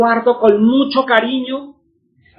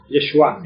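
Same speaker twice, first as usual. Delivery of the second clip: Yeshua stressed on the last A, falling, low; low, measured Yeshua, weight on the closing A; stress on final A; 6 semitones lower than usual. Shown as a demonstration. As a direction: model Yeshua with the accent at the end. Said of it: "Con acento en la A".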